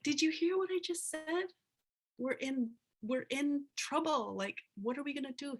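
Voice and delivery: high, agitated retold protest; exasperated disbelief. Youth protesting to the old healer, exasperated. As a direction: act out a youth who can't believe he's being brushed off.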